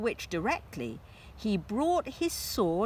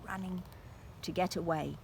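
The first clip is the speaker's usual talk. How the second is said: 1 soft aside; 9 dB quieter, much faster than usual. Real, not acted. Aside to child listener.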